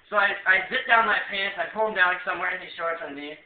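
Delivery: normal talking voice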